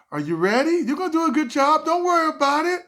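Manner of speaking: high loud fast mock host; host lady. Host lady reassuring nervous comic, high mock voice. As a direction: chirp it high.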